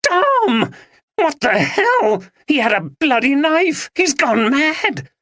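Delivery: high, slow, horrified shouts; panicked shouting. Acted. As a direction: scream in shock after the attack. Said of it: "now screamed and shouted".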